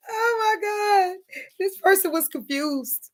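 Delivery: high, slow, soft laughing-crying; overwhelmed. Real, not acted. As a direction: gasp it out overwhelmed.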